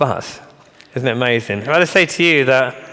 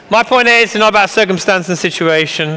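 raised, high-pitched insistence; calling for attention; 7 semitones higher, 6 dB louder than usual. Real, not acted. Speaker to audience after a joke, resuming the argument, insistent and loud. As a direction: drive the point home loudly over the room.

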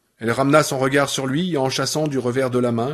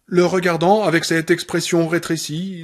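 plain reading voice

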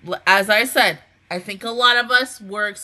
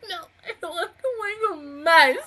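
imitating herself sobbing, a bit higher; hammed-up teary whine; playing her own crying-like-a-baby self; mock crying protest; 9 semitones higher than usual. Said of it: "I'm over here like".

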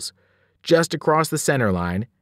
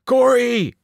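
high, loud cry of alarm; screamed name; 10 semitones higher, 5 dB louder than usual. A narrator's reading. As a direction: scream a warning to a teammate. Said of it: "I screamed".

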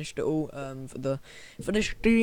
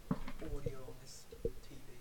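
whisper; 4 semitones lower, 23 dB quieter than usual. Real, not acted. Whispered aside.

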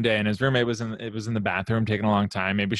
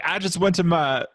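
a hint of a British accent, higher; 7 semitones higher than usual. Friend mocks the lyric guy in a British accent. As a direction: start a British accent, then stop. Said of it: "I'm not gonna do the accent".

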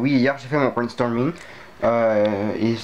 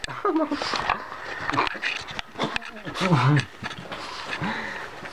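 soft laugh; 9 semitones higher, 6 dB quieter than usual. Real, not acted.

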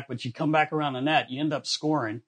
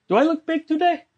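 put-on much higher, eager childlike question; goofy high voice; 4 dB louder than usual. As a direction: ask it like a proud kid. Goalie voiced asking reassurance.

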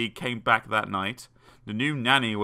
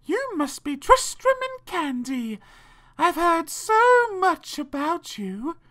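high, drawn-out spider voice; very high, slow spider; spider nanny greeting; slower than usual. A narrator's reading. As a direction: greet them slowly in a high creature voice.

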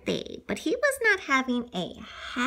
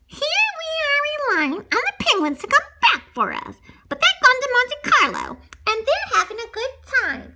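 higher, louder lion voice, exasperated; Alex's voice; 13 semitones higher, 5 dB louder than usual. Acted. Alex grumbling to his friends about the penguins, higher and louder. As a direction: complain to the gang, voice pushed up. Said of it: "Alex asked".

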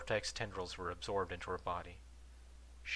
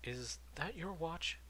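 slow, pleading question; doctor begging to know about the watch; eager doctor; 9 semitones higher, 4 dB quieter, slower than usual. A narrator's reading. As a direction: ask as if it matters desperately. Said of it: "desperately wanting to know".

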